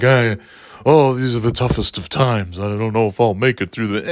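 hammed-up woe over the cold; a touch of melodrama about the toughest times; mock dramatic lament. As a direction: act like winter is killing you. Mock complaint about harsh winter.

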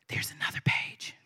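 whispered aside. Real, not acted. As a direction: breathe it unvoiced. Reader repeats it under her breath.

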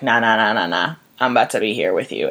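a dark, lazy nah nah nah; low taunting grief voice. Pretend. Grief answering the widow, low and mocking. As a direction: drop low and smug, like grief refusing to leave.

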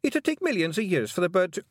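high-pitched exasperated protest; agitated high outburst. Acted. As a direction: object urgently until cut off. Fionn's protest, cut off.

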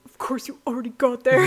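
playful retold grumble; mock annoyed. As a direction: imitate his exasperated grumble. Retold teasing husband.

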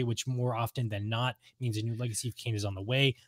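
ordinary delivery

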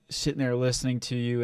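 ordinary talk

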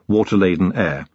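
usual speaking voice

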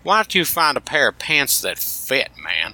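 lightly imitated South Jersey country twang; a hint of a rural South Jersey twang. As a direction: mimic a coworker's Southern-sounding Jersey twang, starting high and dropping at the end. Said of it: "little twang over there".

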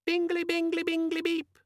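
very high, squeaky chirp; cheerful squeaky demon. A narrator's reading. Pocket demon chirping an alert, cheerful squeaky voice. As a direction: chirp like a perky little gadget. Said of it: "said a cheerful voice".

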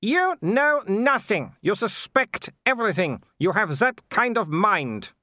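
high-pitched imperious aristocrat; scornful Baroness. A narrator's reading. Baroness scorns the threatening visitors, high and haughty. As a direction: look down on them, voice pitched far up.

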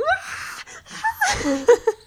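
squealing laughter; high shrieking laugh. Real, not acted. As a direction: crack up high. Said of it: "laughing fit".

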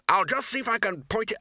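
high, strained put-on voice; struggling to steer. A narrator's reading. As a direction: call out while wrestling the controls. Broomstick flyer struggling to steer.

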